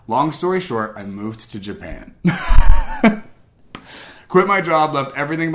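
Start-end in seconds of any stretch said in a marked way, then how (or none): 2.2-3.3 loud, high burst of laughter; real high-pitched laugh. Real, not acted. Laughs at moving to Japan.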